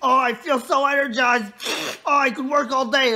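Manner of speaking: loud, high hammed-up boss voice; exaggerated energized outburst; coffee-fuelled boss. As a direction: play the boss buzzing on coffee. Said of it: "he just slurps his coffee like".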